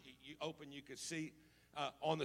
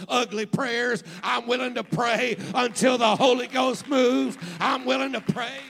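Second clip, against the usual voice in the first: loud, higher, fervent revival preaching; full-force preaching; 6 semitones higher, 13 dB louder than usual. Acted. Preacher declaring to the congregation a willingness to pray, preaching at full force. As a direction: build from firm declaration to shouted vow.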